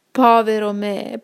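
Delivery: slow, sorrowful first cry of woe; lamenting. A narrator's reading. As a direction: start the lament slowly and mournfully. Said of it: "cominciò a lamentarsi ad alta voce". (primo lamento lento e addolorato)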